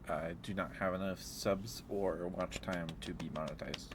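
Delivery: ordinary talk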